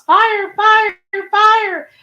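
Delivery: high, repeated alarm call; talking smoke detector. Retold or imitated. Reading viewer's comment on talking alarm, high mock alarm voice. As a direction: imitate a smoke alarm calling out fire. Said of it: "Our smoke detector talks".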